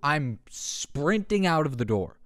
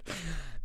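laugh; 5 semitones higher, 11 dB quieter than usual. Real, not acted. Laughing at own joke.

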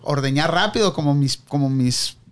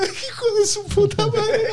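whimpering in pain. Retold or imitated. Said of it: "estoy como pinche nena".

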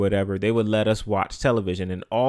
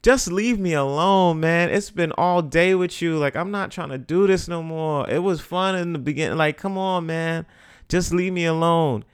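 put-on whiny kid plea; 7 semitones higher than usual. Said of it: "And I'm like".

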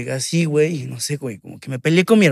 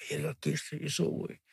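hushed awe; 15 dB quieter than usual. Retold or imitated. Awestruck at the bassist.

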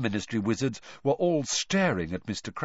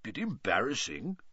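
grim; 7 dB quieter than usual. A narrator's reading. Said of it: "Mister Diggory said grimly".

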